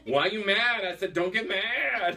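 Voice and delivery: high-pitched, hammed-up scolding; mock exasperation. Joking with guest about not listening, mock exasperated. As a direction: play-act losing patience, stretch the last words.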